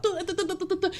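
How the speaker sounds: high, soft mocking du-du babble; mock chatter noise. Vlogger mocking a nagging woman, high babble. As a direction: imitate endless yapping.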